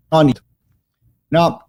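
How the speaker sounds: usual speaking voice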